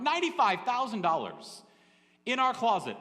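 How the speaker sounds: incredulous. Retold or imitated. Husband confronting wife about hidden money, stunned. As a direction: blurt it out, stunned by the sum. Finding cash hidden in closet.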